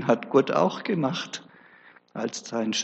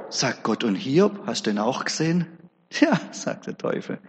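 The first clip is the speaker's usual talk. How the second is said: ordinary delivery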